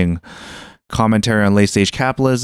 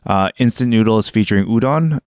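usual speaking voice

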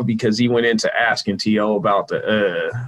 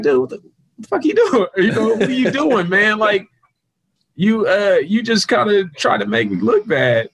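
much higher, exasperated call-out of the reporter; mock scolding; 9 semitones higher than usual. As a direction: ask him what he's doing, pitched way up in disbelief. Confronting the reporter after interview.

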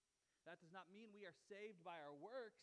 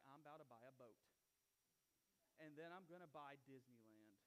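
lower, softer mock dreamy boast; giddy lottery winner; 8 semitones lower, 5 dB quieter than usual. Lottery winner boasting of his spending, low and hushed. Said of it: "You're gonna be like".

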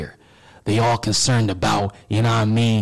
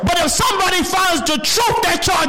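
high, shouted panic of someone hiding; anguished hiding person. Acted. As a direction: cry out as someone terrified of being found out. Imagined person fearing exposure.

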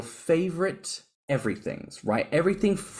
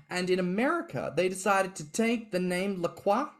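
ordinary delivery

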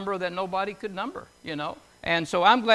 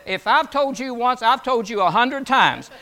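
imitating mom; 5 semitones higher, 6 dB louder than usual. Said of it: "my mom said".

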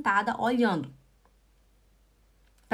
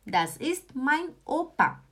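das ist mein Opa said slowly, every word clear; slow model sentence; 3 semitones higher, slower than usual. Shown as a demonstration. Teacher modelling for students, slow and deliberate. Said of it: "Você pode falar também".

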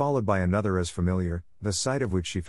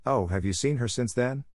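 usual speaking voice

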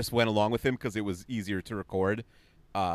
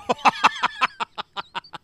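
high burst of laughter; 11 semitones higher, 6 dB louder than usual. Real, not acted. Laughing at a tweet.

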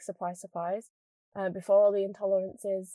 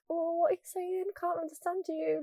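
high, soft teasing imitation; higher-pitched mimicry of puzzled family; mock family voice; 8 semitones higher, 4 dB quieter than usual. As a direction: imitate baffled relatives, pitched up. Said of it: "all my family are like".

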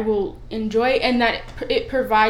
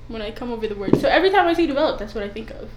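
normal talking voice

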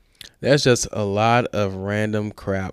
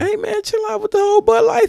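high mock scold. Teasing scolding friend.

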